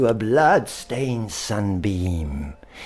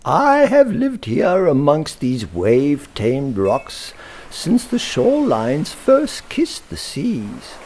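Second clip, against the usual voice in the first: ancient rabbit; 8 semitones higher, 4 dB louder than usual. Acted. Rabbit of the Rocks speaks.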